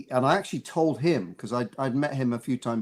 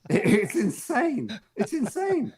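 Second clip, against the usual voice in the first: high-pitched incredulous exclamation; amused disbelief; 11 semitones higher, slower than usual. Real, not acted. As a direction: marvel at how absurd it is. Laughing at his absurd accent choice.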